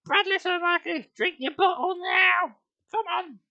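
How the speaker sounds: very high, loud mother's chiding; high patient mum voice. Acted. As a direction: gently correct the baby. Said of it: "she said patiently".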